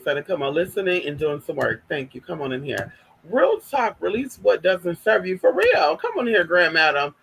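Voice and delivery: usual speaking voice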